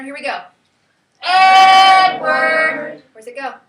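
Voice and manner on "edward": loud, high, long sung-out name; calling to dinner. Shown as a demonstration. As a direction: holler the name as if calling a child in. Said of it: "Call it to dinner".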